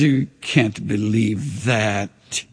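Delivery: low, slow whisper of doubt; voice of the enemy. Acted. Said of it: "the enemy says".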